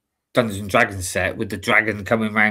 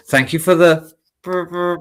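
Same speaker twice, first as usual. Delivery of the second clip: higher, louder attempt at Welsh; Welsh accent; 7 semitones higher, 6 dB louder than usual. Shown as a demonstration. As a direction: stumble into a Welsh accent, rolling the r. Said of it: "You can do the Welsh accent".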